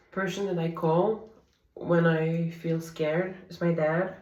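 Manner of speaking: usual speaking voice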